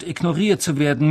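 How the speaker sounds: ordinary delivery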